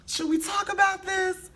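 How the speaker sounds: high put-on voice, prying; mock concerned. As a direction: ask in a high, prissy gossip voice.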